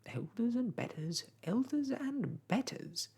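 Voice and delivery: high, squawking conceited bird; strutting bird's self-important squawk; screaming starling. A narrator's reading. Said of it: "he screamed".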